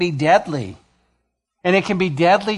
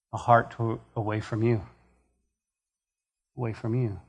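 quiet prayer; 4 semitones lower, 4 dB quieter than usual. Acted. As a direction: pray it low and gently, fading away. Leads the church in prayer.

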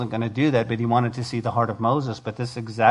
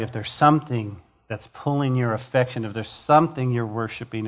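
usual speaking voice

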